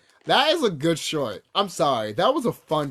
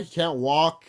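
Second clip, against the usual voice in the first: ordinary talk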